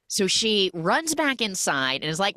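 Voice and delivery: ordinary delivery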